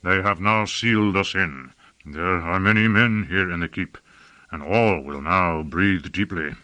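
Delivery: low, grave character voice; grave foreboding. Acted. Master tells apprentice they are sealed in, low and grave. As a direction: drop the pitch and state it with resignation. Hein Huss predicts their doom.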